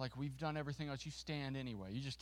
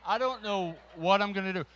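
retold panic; 4 semitones higher, 11 dB louder than usual. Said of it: "I'm scared to death".

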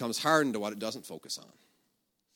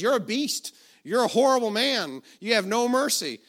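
loud, high-pitched outraged accusation; retold scream; 8 semitones higher, 7 dB louder than usual. Lady in the congregation condemning the healer, screaming. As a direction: cry out in mock outrage. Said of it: "screams. She says".

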